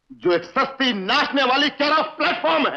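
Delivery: high, heated insult; outraged, pitched well up; angry denunciation. Acted. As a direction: spit out the accusation with rising anger. Quarrel, insults the friend's fiancée.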